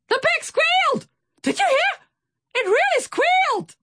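very high, shrill little voice; excited shout. A narrator's reading. Swordfish tells his captain about the pig, shouting excitedly. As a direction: squeal the news in excitement. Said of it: "he shouted excitedly".

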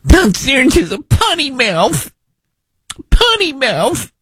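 put-on high, slow babyish taunt; radio host mocking senator in a high whine. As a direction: make fun of him like a pouting kid.

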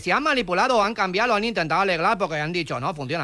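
put-on foreign accent with L for R; foreign-accented broken Spanish; mock foreign accent. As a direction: play a foreigner mangling Spanish, R turned to L. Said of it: "si no sabe hablar castellano".

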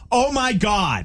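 high-pitched, hammed-up panic cry; mock alarm. Prankster playing along with scam warning, mock alarm. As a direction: play up being horrified by the news.

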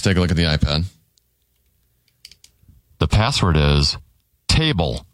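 low, confiding game host aside; hushed password reveal. Acted. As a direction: drop the voice low to tell the home audience the secret word.

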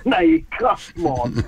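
put-on a light, half-hearted South African accent. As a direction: toss it off in a passable mock South African accent. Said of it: "No, that was South African".

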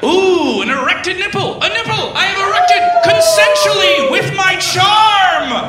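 very high, held-out mock shriek; over-the-top high-pitched delight; hammed-up high voice. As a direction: push it way up into a silly squeal. Acted hammed-up outburst over the kiss.

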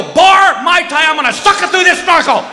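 high loud blurted answer; shouting to his wife while running to the bar; frantic shout. Retold or imitated. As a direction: yell it over your shoulder. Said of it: "I go".

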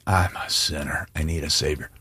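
a preacher leading the congregation in a hushed confession. Shown as a demonstration. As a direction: say it quietly with the congregation, like a prayer. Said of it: "So say it with me".

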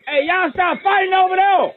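put-on high-pitched yell across the room at the kids; hollering at rowdy kids to stop fighting; calling out. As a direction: shout over the noise to stop the kids fighting.

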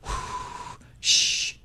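breathy imitated rush of steam; soft whoosh. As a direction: blow out a quiet geyser whoosh. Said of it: "it just kinda goes".

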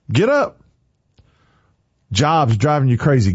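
ordinary talk

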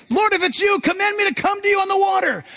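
high, pleading call; eager Peter. Retold or imitated. Peter calling to Jesus from the boat, high and eager. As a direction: call out eagerly across the water.

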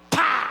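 sharp loud mouth slap noise; slap sound. Pretend. Preacher acts out a slap with a loud pah. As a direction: smack the air like a slap.

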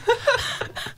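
a high airy burst of laughter; high breathy laugh. Real, not acted. Storyteller laughing at the slang tale, high airy laugh.

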